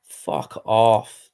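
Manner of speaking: ordinary talk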